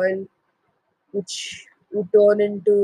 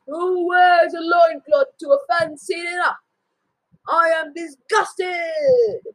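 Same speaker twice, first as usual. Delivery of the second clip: disgusted haughty wife; 8 semitones higher than usual. Acted. Wife mocking husband's loincloth, high haughty disgust. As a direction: sneer with high disdain. Mother mocks his clothes.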